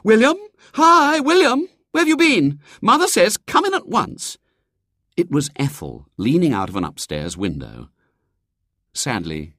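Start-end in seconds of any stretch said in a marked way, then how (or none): 0.0-4.4 high, loud calling out; shrill cry. A narrator's reading. Sister calls William from upstairs window, shrill cry. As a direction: holler shrilly to someone down in the street. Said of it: "when a shrill cry reached".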